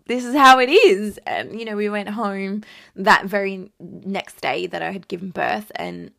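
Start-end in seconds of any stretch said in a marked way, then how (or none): 0.1-1.2 loud acceptance. Retold or imitated. Accepting new body.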